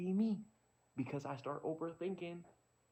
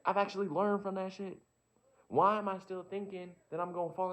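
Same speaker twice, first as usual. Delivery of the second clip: raised, higher, impassioned voice; fired-up indignant pep talk; defiant self-argument; 5 semitones higher, 6 dB louder than usual. Acted. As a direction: challenge yourself loudly, pushing each point.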